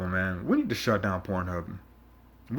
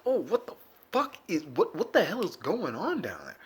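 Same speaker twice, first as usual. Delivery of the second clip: higher-pitched alarmed "what the fuck"; retold alarm; 5 semitones higher than usual. Waking up sore, mock panicked at the pain. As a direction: sound panicked and confused. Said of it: "I was like".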